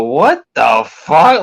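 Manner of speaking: slow, drawn-out stunned disbelief; retold shock. Retelling his reaction to her confession, slow stunned disbelief. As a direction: stretch it out like you can't believe it. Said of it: "I go".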